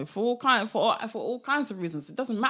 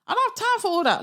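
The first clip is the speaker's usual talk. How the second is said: dismissive; 13 semitones higher, 3 dB louder, faster than usual. Real, not acted. Dismissing doubts.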